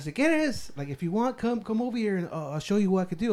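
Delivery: put-on high, lilting Texas Mexican accent, mixing Spanish; imitating Texas Mexican accent. Friend retells the painter's offer in a Texas Mexican accent. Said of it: "they all sound like that".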